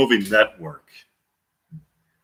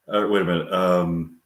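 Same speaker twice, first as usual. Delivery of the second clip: ordinary talk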